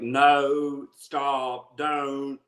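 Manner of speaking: imitated mother-in-law, Appalachian vowels stretched long; dragged-vowel Appalachian accent. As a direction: drag each vowel out in mountain accent. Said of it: "Anytime you hit a vowel, that thing drags out".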